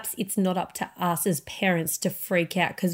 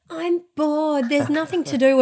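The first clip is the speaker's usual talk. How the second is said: mimicking a whining kid, pitched up; a light, higher-pitched impression of complaining children; bored child; 7 semitones higher than usual. Voices her bored, complaining children.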